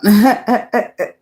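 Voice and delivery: a short loud laugh that falls away. Real, not acted. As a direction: laugh at whoever the song is about. Laughs at the listener.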